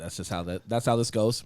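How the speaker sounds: usual speaking voice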